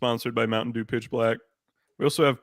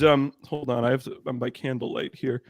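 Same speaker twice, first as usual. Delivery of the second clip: ordinary talk, nothing marked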